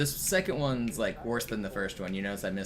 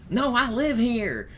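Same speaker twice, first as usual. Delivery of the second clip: indignant high retort; rude; 14 semitones higher, 8 dB louder, slower than usual. Retold or imitated. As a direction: snap back, offended. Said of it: "very rude about it".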